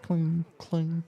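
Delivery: high, ringing imitation of metal; spaced, high-pitched metallic clinks; balanced hammer clang. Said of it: "the very balanced sound of".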